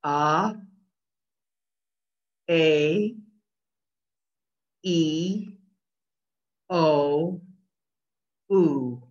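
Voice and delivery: each vowel said slowly and separately as a pure Japanese sound; Japanese vowels demo. Shown as a demonstration. Teacher modelling Japanese vowels for students to repeat. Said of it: "Repeat after me".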